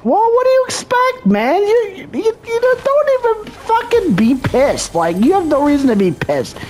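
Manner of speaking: high-pitched scoffing rant at the sore loser; ranting at the opponent who's mad; mocking rant. Real, not acted.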